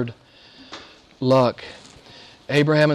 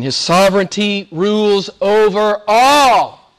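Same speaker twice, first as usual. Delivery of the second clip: slow, swelling scripture proclamation; preaching proclamation; 5 semitones higher, 5 dB louder, slower than usual. Real, not acted. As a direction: proclaim it slowly, building to the last word. Preacher proclaims a Psalm verse.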